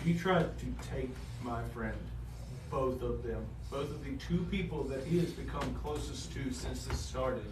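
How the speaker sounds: low, hushed storytelling; game master's narration. Acted. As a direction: narrate it softly and low, with weight.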